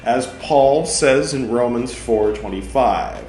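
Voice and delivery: loud, emphatic reading of Luther's words; forceful sermon reading. Acted. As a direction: argue it out from the pulpit. Reads Luther's text aloud.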